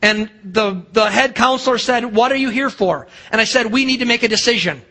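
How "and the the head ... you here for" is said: ordinary talk, nothing marked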